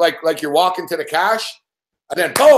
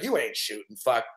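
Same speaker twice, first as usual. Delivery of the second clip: low muttered defiance; 9 semitones lower, 9 dB quieter than usual. Retold or imitated. Defiant thought fleeing cops.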